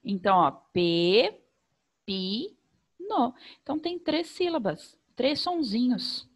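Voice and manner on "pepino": pepino said in three separate beats, pe-pi-no; teacher syllabifying for children; split into syllables. Shown as a demonstration. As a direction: open the mouth three times: pe-pi-no. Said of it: "abre a boca três vezes pra falar".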